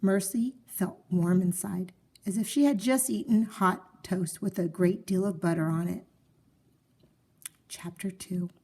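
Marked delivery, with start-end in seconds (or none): none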